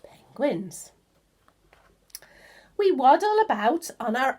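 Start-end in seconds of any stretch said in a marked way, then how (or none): 0.0-0.9 soft whispered title; hushed whisper to the child. Shown as a demonstration. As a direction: breathe the word out. Whispered heading word.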